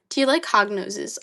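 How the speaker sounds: usual speaking voice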